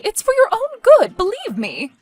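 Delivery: very high, loud insistence; emphatic justification. Acted. Mother justifies the eviction.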